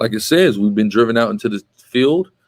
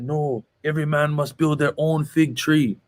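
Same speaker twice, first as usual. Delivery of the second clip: slow, higher-pitched imitated Jamaican accent; 5 semitones higher, 3 dB quieter, slower than usual. Teacher quoting the Rastafarian's answer to his class, imitated Jamaican accent.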